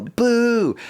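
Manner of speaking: a high, loud boo like a heckler; mock booing yell. Imitating the angry crowd booing the singer, loud. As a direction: boo him off the stage. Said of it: "like, yell".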